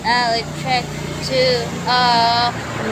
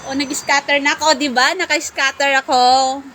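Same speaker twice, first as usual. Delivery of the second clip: louder, fast, thrilled burst; excited; 4 semitones higher, 6 dB louder than usual. Real, not acted. Streamer celebrating a scatter hit in the game, loud and fast.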